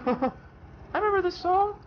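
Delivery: high mock grandma voice with a laugh; imitating grandma. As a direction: squeak it out like a giddy grandma. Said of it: "and she's like".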